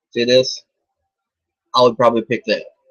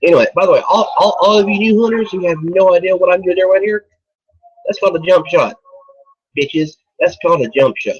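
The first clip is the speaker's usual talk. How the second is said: higher, louder showing off; cocky boasting; 7 semitones higher, 3 dB louder, faster than usual. Real, not acted. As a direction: brag to the newbies. Boasting to new hunters.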